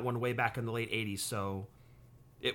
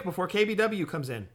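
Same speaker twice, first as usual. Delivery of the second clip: normal talking voice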